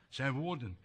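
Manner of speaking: low, hushed aside; soft echo. Shown as a demonstration. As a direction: drop the voice to a murmur.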